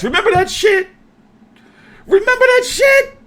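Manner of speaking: high mocking squeal, rising to the top; mock squeaky voice. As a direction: squeak it out like a cartoon. Mocking squeak recalling a flop.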